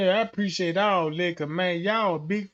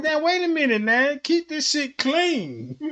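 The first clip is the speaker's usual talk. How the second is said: mock protest; 7 semitones higher than usual. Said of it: "I'm like".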